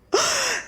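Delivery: sharp high gasp of fear; frightened gasp. A narrator's reading. Narrator gasping as the girls get scared. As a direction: suck in a startled breath. Girls realise parents are gone.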